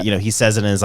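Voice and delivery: usual speaking voice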